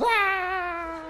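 high scream fading behind doors; labor scream. Retold or imitated. Wife behind hospital doors, high imitated scream. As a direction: imitate a distant pained cry. All you hear is.

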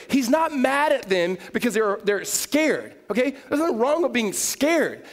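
high, loud, impassioned sermon delivery; full-force preaching. Acted. Preacher voicing Jesus to fearful followers, full-force preaching. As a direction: drive each phrase out loud and high.

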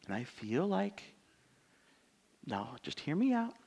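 soft, gentle, reasonable tone; calm talk. Shown as a demonstration. Pastor modelling a calm talk with a spouse, softly. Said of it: "to calmly talk about the facts".

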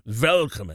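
"Willkommen" begun with a V; "Willkommen" with a German V for the W; V sound. Shown as a demonstration. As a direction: demonstrate "Willkommen" as "Villkommen". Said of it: "I think it's more of a V sound".

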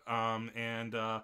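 ordinary talk, nothing marked

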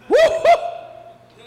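high, loud woo-hoo whoop; excited whoop. Real, not acted. Comic cheering friend's smooth name, excited whoop.

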